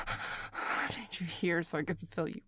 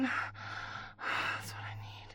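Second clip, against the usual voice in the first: very slow soft whisper; 6 dB quieter, much slower than usual. Acted. Caller whispering a need to an absent partner, slow whisper. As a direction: draw it out in a whisper.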